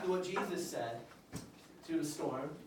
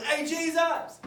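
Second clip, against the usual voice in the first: calling out; 9 semitones higher, 5 dB louder, slower than usual. Acted. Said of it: "So Peter goes".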